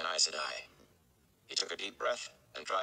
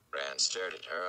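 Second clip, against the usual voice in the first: plain reading voice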